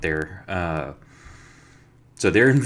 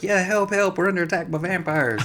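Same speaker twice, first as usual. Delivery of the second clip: much higher, hammed-up call for help; mock panicked cry; 11 semitones higher than usual. Ranger echoes the fake alarm at the guards, high mock squeal. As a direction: play-act the panic up high like a squealing victim.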